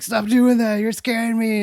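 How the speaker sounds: high, loud frightened plea; high scared plea. Retold or imitated. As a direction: beg her to stop, spooked.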